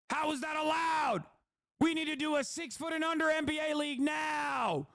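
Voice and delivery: high, shouted mock whining; shouting like a whining crybaby; whiny complainer impression. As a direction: ham up a crybaby fan's outrage. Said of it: "That's what you sound like".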